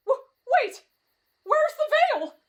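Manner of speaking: high, frightened cry; stammer, then cry out in panic; panicked stammer. Acted. Exit has vanished.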